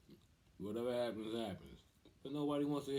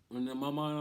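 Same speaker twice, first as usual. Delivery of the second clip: normal talking voice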